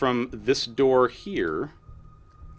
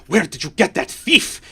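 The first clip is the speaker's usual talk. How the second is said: accusing demand; 5 semitones higher than usual. Acted. Lady accusing party of theft.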